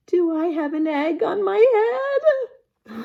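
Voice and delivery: anxious question to his friend; lightly voiced nervous elephant; worried Elephant. Elephant asks Piggy, worried.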